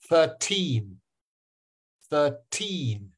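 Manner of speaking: put-on thirteen with a long, falling TEEN; thir-TEEN, slow and deliberate; stress on second syllable. Shown as a demonstration. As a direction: lean hard on the teen of "thirteen". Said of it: "hear the second syllable being stressed".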